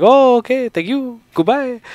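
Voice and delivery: very high mock French accent; imitated French speaker. French man gives up on reporter, high mock French accent. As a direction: sing out a polite, high French-accented farewell. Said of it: "then he started speaking French".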